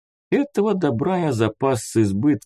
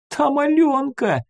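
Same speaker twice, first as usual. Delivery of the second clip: put-on very high, whimpering little-boy voice; sobbing child. A narrator's reading. Small boy tells the man about Алёнка, sobbing. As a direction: wail it like a small boy in distress. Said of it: "всхлипнул малёк".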